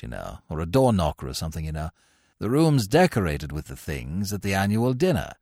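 low-pitched upper-class student explaining himself; mild, earnest young gent recounting a prank; apologetic young chappie, chatty. A narrator's reading.

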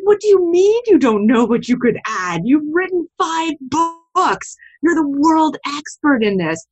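high, loud, incredulous protest; horrified disbelief. Retold or imitated. Retelling to the podcast host her horrified reaction to a panelist, incredulous and high.

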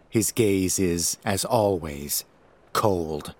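ordinary delivery

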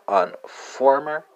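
"informer" said slowly in French; French word said slowly. Shown as a demonstration. Teacher slowly sounding out "informer" for French learners. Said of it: "let's translate the Portuguese to the French".

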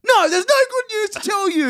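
high, loud, fed-up mock snap; exasperated retort. Telling friends checking in, exasperated high retort. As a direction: throw it back like you're sick of being asked. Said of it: "It's like".

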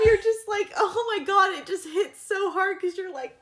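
high-pitched, weepy gushing; overcome, voice climbing toward tears; tearful, choked up. Real, not acted. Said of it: "we're all three crying over this".

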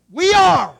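high, very loud cry in unison spirit; shouted answer. Acted. People answering Moses's call, shouted. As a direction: shout it back as a whole crowd.

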